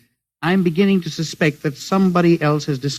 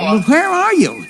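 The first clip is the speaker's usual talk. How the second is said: higher, slow, pressing; urgent question; 11 semitones higher, slower than usual. Acted. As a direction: demand to know where he is. Urgent question over the radio.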